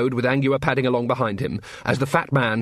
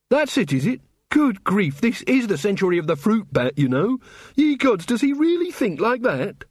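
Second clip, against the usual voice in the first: high-pitched exasperated disbelief; indignant; 8 semitones higher than usual. A narrator's reading. Angua reacts to prejudice against recruits, indignant. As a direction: voice an exasperated outburst, pitched well up.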